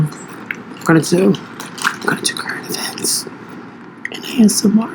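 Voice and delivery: slow, soft whisper; ASMR whisper. Acted. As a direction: whisper it slowly into the mic. Opening the ASMR segment.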